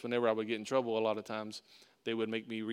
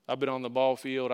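usual speaking voice

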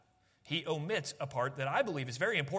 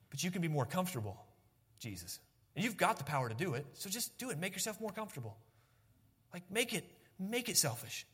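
softly voiced devil, lightly acted; tempting devil; 6 dB quieter than usual. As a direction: coax quietly, a hint of the tempter. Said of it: "devil was doing here is he was trying to tempt Jesus".

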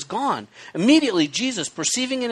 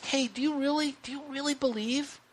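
soft, gentle, higher questions; gentle asking; 3 semitones higher, 10 dB quieter than usual. Retold or imitated. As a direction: ask it softly and kindly. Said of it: "I think it's okay to ask".

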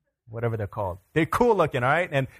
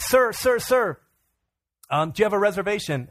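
high, urgent club host; insistent greeter; 8 semitones higher, 3 dB louder than usual. Retold or imitated. Greeters stopping a guest for his reservation, high and insistent. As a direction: call out politely but pressingly, pitched up.

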